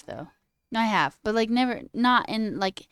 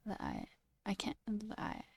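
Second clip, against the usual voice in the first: ordinary delivery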